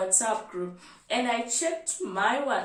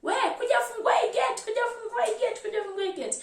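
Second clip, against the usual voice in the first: retold shouting; 11 semitones higher, faster than usual. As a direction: call out to open the gate, imitating the patients. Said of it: "they start shouting".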